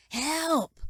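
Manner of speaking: high, drawn-out call for help; yelled cry for help. A narrator's reading. Injured man calling from the floor, high strained yell. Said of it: "Lamech yelled".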